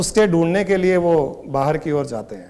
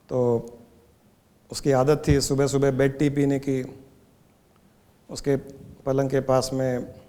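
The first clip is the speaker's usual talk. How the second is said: low-key, softer parable telling; hushed, measured storyteller; story narration; 3 semitones lower, 5 dB quieter than usual. Acted. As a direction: tell the story quietly and evenly, with long pauses. Tells a parable.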